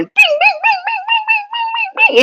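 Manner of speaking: very high, soft sound-effect bings; tiny high electronic pings; imitated bings. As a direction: chime it like little bells going off. Imitated sound of things activating.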